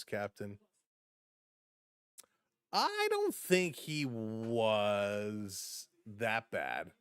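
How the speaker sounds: normal talking voice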